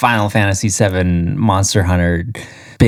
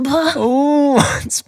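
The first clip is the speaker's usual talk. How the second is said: excited yelp. Real, not acted. Excited exclamation.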